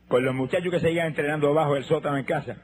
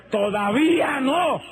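voice of thunder; 8 semitones higher, much slower than usual. Retold or imitated. God answering the sick man in a thundering shout. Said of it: "pero con voz de trueno".

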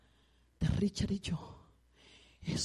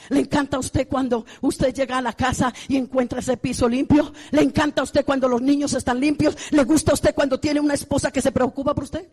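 loud, higher preaching questions to the crowd; rousing preaching; 5 semitones higher, 8 dB louder than usual. Acted. Preacher pressing married men in the church with loud questions.

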